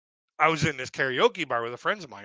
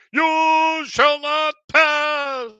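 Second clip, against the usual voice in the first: booming, very high, drawn-out wizard shout; hammed-up wizard blocking the way, each word stretched; Gandalf; 13 semitones higher, 9 dB louder, much slower than usual. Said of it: "little picture of Gandalf".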